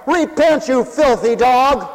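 high, loud condemning shout; harsh accusation. Acted. Accuser calls a man to repent, loud harsh accusation. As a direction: drag out the rebuke, loud and high.

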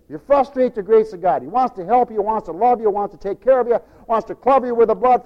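ordinary delivery

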